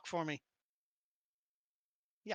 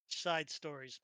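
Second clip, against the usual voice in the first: softer, lower repeat; low aside; 3 semitones lower, 8 dB quieter, slower than usual. Acted. Game master echoing the detour label to the players, quiet low aside. Same words called out, then dropped.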